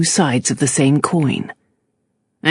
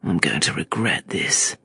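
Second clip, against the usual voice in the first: much lower, softer sour grumble; 12 semitones lower, 8 dB quieter than usual. A narrator's reading. Gentry sourly accepting the bargain, a low sour grumble. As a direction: sink the voice into resentment. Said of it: "he said sourly".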